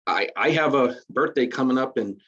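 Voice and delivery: usual speaking voice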